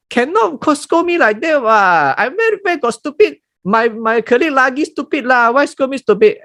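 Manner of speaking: high-pitched aggrieved whining; indignant rant. Retold or imitated. Offended worker ranting at boss.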